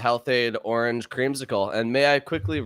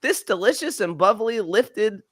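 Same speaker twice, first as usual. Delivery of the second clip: put-on high, bouncy little beach kid; kid surfer voice; 8 semitones higher than usual. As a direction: read it like an excited kid at the beach. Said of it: "I'm gonna do, like, a kid surf-, surfer".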